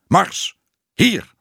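high, loud command to a dog; calling a dog. A narrator's reading. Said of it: "De hond werd geroepen". (luid een hond roepen)

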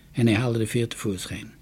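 ordinary delivery

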